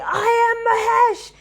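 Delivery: high, loud playful shout; mock proclamation. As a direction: shout it like a boy pretending to be Shiva. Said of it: "and he would say".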